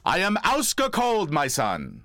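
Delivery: high, slow, grand pronouncement; dad announcing the cold to his son, lofty and slow; father's voice. Retold or imitated. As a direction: declaim it like a big booming dad. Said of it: "as in".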